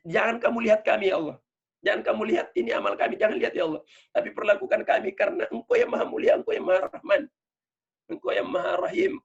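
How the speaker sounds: imploring prayer, easing down at the end; beseeching God. Acted. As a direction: plead with God in a high, insistent voice. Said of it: "salah satu cara mengadu kepada Allah".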